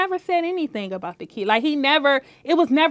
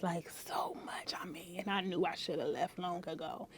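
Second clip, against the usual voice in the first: whisper; 7 semitones lower, 18 dB quieter than usual. Real, not acted. Confiding about past relationship.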